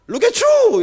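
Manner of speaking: "look at you" in a high, loud, hammed-up North American accent; mock the buttery pronunciation of "look at you". Pastor mocking a colleague's overdone North American accent. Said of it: "버터 발음이 돼가지고".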